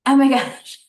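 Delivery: loud, high burst of astonishment; amazed realisation. Retold or imitated. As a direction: let the revelation hit you out loud. Amazed at husband's calm words.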